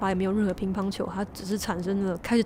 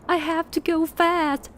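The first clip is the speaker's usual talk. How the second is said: mildly urgent, pitched high; 7 semitones higher than usual. Acted. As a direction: read it as a worried driver insisting. Urgent drive to the hospital.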